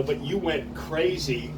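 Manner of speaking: normal talking voice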